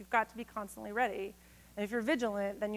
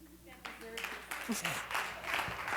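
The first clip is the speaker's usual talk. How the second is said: soft laugh; 9 dB quieter than usual. Real, not acted. Laughing at end of poem.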